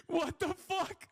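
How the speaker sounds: stunned and slow, pitch way up; shocked disbelief. Real, not acted. Shocked at the debate summary.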